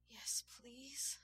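high, breath-laden plea; pleading. A narrator's reading. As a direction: beg in a high, whispery voice. Submissive character begs her partner.

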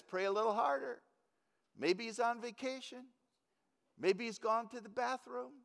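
high-pitched mock-helpful suggestions; sarcastic taunt. Elijah taunting the prophets of Baal, sarcastically. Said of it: "it's clearly understood that he's using sarcasm".

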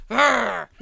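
short, loud "arr" growl; rough pretend roar; imitated wild-man growl. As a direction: growl like a crazy bearded man. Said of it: "the voice you expect to come out".

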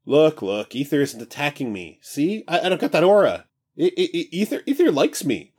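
high, breezy, carefree god; casual new voice. Acted. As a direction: stretch and chat like just woken. King reassures Parker.

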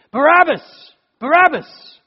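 high, loud chanted shout of the name; crowd shout. Retold or imitated. Preacher voicing the crowd calling for Barabbas, loud shouted chant. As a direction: cry it out like a mob.

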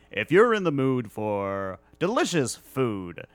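slow booming commercial plug; hammy radio ad announcer; ad read voice. Pretend. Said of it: "do a plug".

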